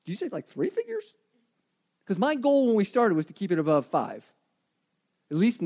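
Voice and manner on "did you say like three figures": high, soft stunned question; can't believe the number; stunned disbelief. Retold or imitated. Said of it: "And I went".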